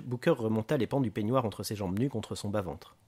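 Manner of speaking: plain reading voice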